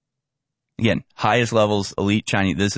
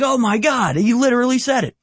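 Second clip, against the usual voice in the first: can't believe the clip just said it; stunned disbelief; 12 semitones higher than usual. Real, not acted. Host reacts to the clip's Godfather line, stunned and high.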